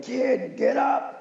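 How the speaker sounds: high, raspy old sports-writer impression; imitating a raspy old-timer's wake-up call. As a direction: rasp it out like an old sports PR guy. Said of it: "he had that raspy voice".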